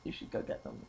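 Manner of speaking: soft, half-whispered offer; quiet aside. Real, not acted. Telling the friend off-mic about cupcakes downstairs, quiet murmur. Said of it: "aside to friend quietly".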